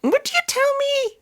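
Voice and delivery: high squeaky voice. Pretend. Mock high announcer question.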